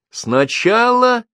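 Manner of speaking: high, very slow, drawn-out accusing word; mother's high-pitched, deliberate charge; pronouncing an accusation. A narrator's reading. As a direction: stretch it out like a prosecutor. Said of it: "выносила она обвинение".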